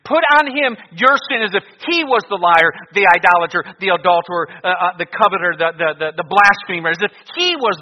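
high, loud fire-and-brimstone preaching; doomsday street preacher. Acted. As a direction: thunder out the warning of judgment.

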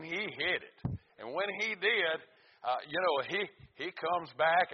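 loud, ringing pulpit voice; fiery preacher reading the Psalms; preaching at full force. Acted. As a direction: preach it out to the congregation. Tells a church story from the pulpit.

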